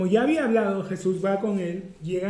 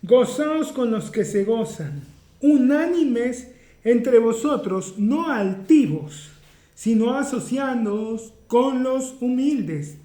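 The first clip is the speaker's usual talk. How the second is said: slow, louder, deliberate Bible reading; solemn scripture reading; 3 semitones higher, 5 dB louder, slower than usual. Acted. Preacher reading the Romans verse to the congregation, slow and solemn. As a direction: proclaim each phrase of the verse slowly.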